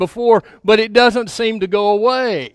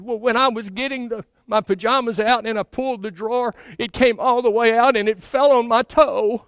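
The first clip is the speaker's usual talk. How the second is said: put-on lightly higher, softer small-boy voice; little boy voice; 5 semitones higher, 3 dB quieter than usual. Preacher voicing the crying boy, lightly higher and softer. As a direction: meekly answer as a hurt little boy. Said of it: "He said".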